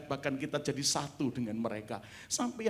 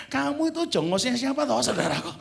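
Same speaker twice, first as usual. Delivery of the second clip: higher and louder, leaning on the insult; mock-indignant David; 9 semitones higher, 7 dB louder than usual. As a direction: fling the slur like a sneering master. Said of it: "dijongos-jongosin ya".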